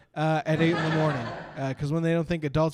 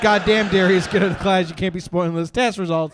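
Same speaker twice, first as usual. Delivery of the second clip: louder hyped yell; loud call-out; 2 semitones higher, 9 dB louder than usual. Retold or imitated. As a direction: hype up a classmate loudly. Class reacts to the kid.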